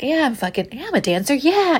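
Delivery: lightly mock self-assured bravado, pitch lifting; fake confidence. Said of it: "I'm trying to fake it".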